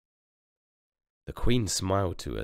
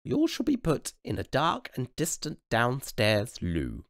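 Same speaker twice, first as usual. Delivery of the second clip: queen dismissing; 8 semitones higher than usual. Acted.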